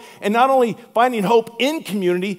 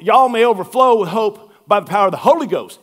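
louder Southern read, "Holy" stressed on HO; Deep South accent; 5 dB louder than usual. Retold or imitated. Pastor reading the verse Deep South style, loud. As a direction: preach it like a Louisiana church. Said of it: "It's not Holy Spirit, it's Holy Ghost".

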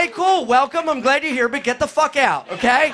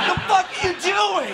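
high, indignant squawk at normal volume; mock exasperated outburst; 8 semitones higher than usual. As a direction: explode at dawdlers, hammed-up. Acted outburst at dawdling tourists.

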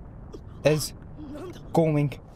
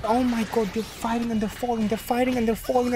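usual speaking voice

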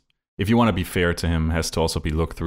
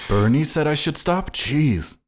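higher-pitched mock incredulous voice; mock dismay; 8 semitones higher than usual. Co-host playing Netanyahu hearing Bernie's request, mock dismay. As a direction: play it as a hammed-up reaction to being scolded.

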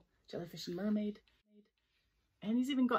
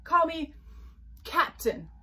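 loud, higher, self-important; hollered command; 9 semitones higher, 8 dB louder, slower than usual. Acted. Unicorn demands a title, hollering. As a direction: demand your title loudly. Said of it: "hollered Unicorn".

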